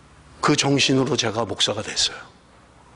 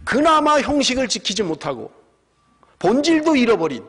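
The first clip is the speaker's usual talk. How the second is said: impassioned preaching; 6 semitones higher than usual. Acted. Preaching at full force.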